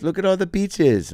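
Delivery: very high mimicry, "beaches" for fishes; high little voice sincerely saying "beaches"; mispronounced fishes. Imitating him repeating it for the phone, mispronounced in a high voice. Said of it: "it's coming out as bitches".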